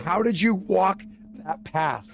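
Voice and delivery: high, loud, slow incredulous question; exasperated disbelief. Retold or imitated. Retelling the question to his friend, slow high baffled. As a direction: drag it out, baffled.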